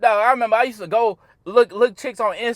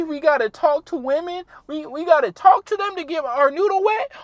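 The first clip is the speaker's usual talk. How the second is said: whiny complaining guys; 9 semitones higher, 4 dB louder than usual. Retold or imitated. Mocking his complaining viewers, high and whiny. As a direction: mimic a fed-up guy moaning about all the work. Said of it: "some guys gonna be like".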